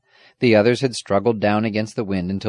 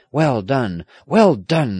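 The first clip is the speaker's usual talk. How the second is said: squire's lightly raised exclamation; exclaiming; 5 semitones higher, 6 dB louder, slower than usual. A narrator's reading. Squire applauding the rescuers, exclaiming. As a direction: cheer them on, rising on the repeat. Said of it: "the squire exclaimed".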